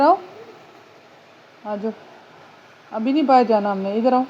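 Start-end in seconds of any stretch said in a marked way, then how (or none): none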